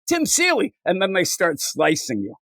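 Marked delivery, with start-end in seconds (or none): 0.1-0.8 very high, squeaky name reply; lightly voiced nervous reader; mock meek answer. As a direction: answer timidly.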